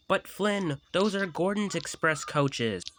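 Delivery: high worried warning; lightly voiced anxious little engine; Thomas voice. Thomas warning Flynn about the coaches.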